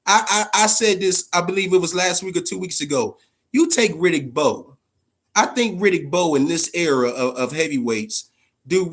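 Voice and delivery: ordinary talk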